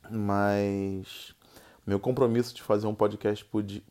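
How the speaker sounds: ordinary delivery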